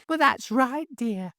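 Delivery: put-on a kindly old widow soothing her friend; sweet old lady. A narrator's reading. Mrs Hines reassuring her friend, high and sweet.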